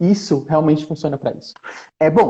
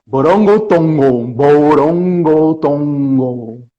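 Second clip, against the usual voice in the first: "borongotongo" stretched very slowly, louder, each syllable rolled; drawn-out nonsense word; 3 semitones lower, 3 dB louder, much slower than usual. Shown as a demonstration. Reading the nonsense word aloud to children, slow and rhythmic. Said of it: "mesmo se a palavra for".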